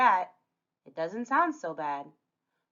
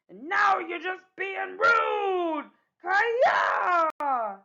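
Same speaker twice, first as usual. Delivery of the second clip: slow, higher, outraged yell; the insulted pirate shouting for help; angry shout; 10 semitones higher, 4 dB louder, much slower than usual. Acted. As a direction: bellow the offence at her. Grandma insulted, yelling.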